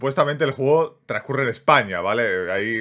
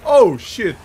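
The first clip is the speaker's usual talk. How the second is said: louder, higher sudden swear; startled curse; 8 semitones higher, 8 dB louder than usual. Real, not acted. Gamer startled by a sudden scare, loud curse. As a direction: jump at the scare and blurt it.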